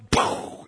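voiceless puff of an explosion; imitated poof. Preacher mimics news blowing up in Zechariah's face, puffed poof. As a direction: blow out a soft, airy "poof".

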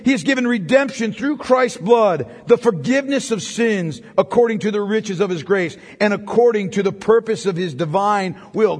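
ordinary talk, nothing marked

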